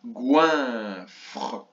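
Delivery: Goinfre with a drawn-out GOIN and separate FRE; split in syllables. Shown as a demonstration. Teacher marking the first syllable, split syllables. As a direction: hold GOIN, then add FRE. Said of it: "dans la première syllabe".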